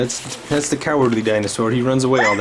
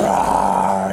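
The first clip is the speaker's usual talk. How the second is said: low growled roar demo; dinosaur roar; 3 semitones lower than usual. Shown as a demonstration. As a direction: growl it out for the kid. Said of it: "Can you go".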